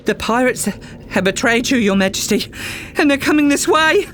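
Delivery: high, hurried alarm, rising to a fast shout; panicked guard blurting out bad news; nervous warning. A narrator's reading. Nervous guard warns the Queen the pirates are coming, high frantic alarm.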